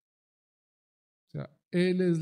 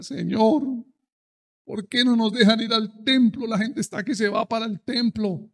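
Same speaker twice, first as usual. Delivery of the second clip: higher, louder plea to the Lord; pleading prayer; 5 semitones higher, 5 dB louder than usual. Retold or imitated. Pastor retelling his prayer to God, loud pleading. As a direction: cry out to God in protest. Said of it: "yo decía".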